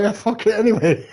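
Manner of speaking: high, loud and gleeful; carefree back to gaming; gleeful shrug-off. Retold or imitated. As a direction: toss it off with glee. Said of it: "be like".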